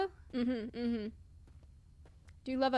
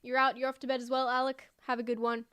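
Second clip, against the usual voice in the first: ordinary talk, nothing marked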